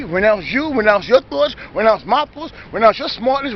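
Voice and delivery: ordinary talk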